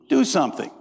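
usual speaking voice

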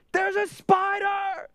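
put-on high, drawn-out child's shriek; little girl screaming. Daughter Glory in the back seat, shrieking high and slow. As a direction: scream it like a terrified small girl.

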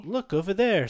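high, loud read-aloud line; voicing a book character; storybook dialogue. Shown as a demonstration. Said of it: "instead of being like".

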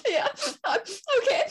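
high, laughing-crying "I'm okay"; mock sobbing reassurance. Telling the conductor she's okay while crying. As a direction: pretend you're fine through tears.

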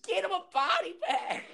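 very high-pitched movie-villain heckle; Bobby Brown impression. Henchman behind Sensei Kreese calling out, high squealed impression. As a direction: squeal it way up high, mimicking the character. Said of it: "All because Bobby Brown's sitting there behind Sensei Kreese".

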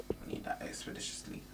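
soft unvoiced whisper. Shown as a demonstration. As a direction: breathe the words with no voice. Repeats line in unvoiced whisper.

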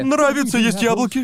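high creepy shinigami voice; squeaky demon craving apples; Ryuk voice. Acted. (высокий жуткий голос синигами)

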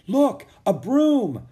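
high, loud, drawn-out exclamation; excited discovery. A narrator's reading. Jack spots a paddle in the water, excitedly. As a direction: call out the find, stretching the words slowly.

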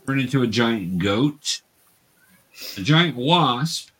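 ordinary talk

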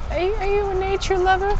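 street fundraiser. Retold or imitated. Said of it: "someone goes".

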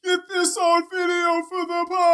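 high, desperate yell mid-fall; frantic shout. Retold or imitated. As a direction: scream it like you're going over the edge. Imagined shout while falling.

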